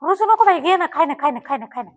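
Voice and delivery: coaxing the cow. Real, not acted. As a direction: sweet-talk a sulky cow into eating.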